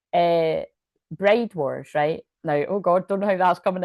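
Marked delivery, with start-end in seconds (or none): none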